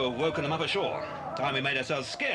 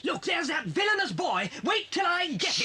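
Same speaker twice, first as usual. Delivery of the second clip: angry shout; 12 semitones higher than usual. Acted. Freed captive yelling at the villainous boy, furious shout. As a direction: threaten the boy at the top of your voice.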